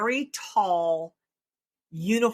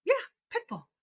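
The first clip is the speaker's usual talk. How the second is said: cheerful; faster than usual. Retold or imitated. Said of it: "I said".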